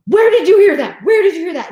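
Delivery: high, loud scolding mother's yell; the mom yelling at her kid over the F word, mock scolding shout; mock angry mom. Mom shrieking at son over swearing, hammed-up yell. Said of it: "the mom is, like, screaming at him".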